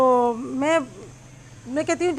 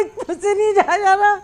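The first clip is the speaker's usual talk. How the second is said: higher, louder, half-crying retelling; imitating a tearful plea; 5 semitones higher, 3 dB louder than usual. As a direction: mimic her crying she can't go on. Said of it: "वो कह रही है". (फँसी सहेली की नकल, रोती हुई आवाज़ में)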